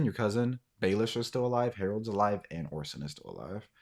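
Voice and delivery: ordinary talk, nothing marked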